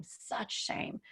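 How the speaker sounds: real whispered such shame, trailing away. Real, not acted. Repeating the shame, then whispering.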